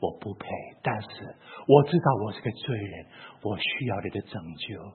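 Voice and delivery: a hushed plea for saving; kneeling prayer. Retold or imitated. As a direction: murmur it on your knees. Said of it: "我跪在那边说".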